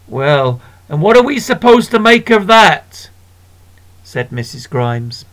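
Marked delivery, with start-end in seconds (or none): 0.1-3.1 indignant woman. Acted. Missus Grimes voiced.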